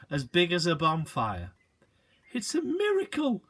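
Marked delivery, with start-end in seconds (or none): 2.3-3.4 awed. A narrator's reading. Awed exclamation.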